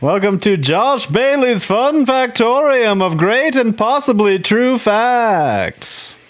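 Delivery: slow, high, booming TV announcer. Pretend. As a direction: announce it like a game show host. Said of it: "That's my T V announcer voice".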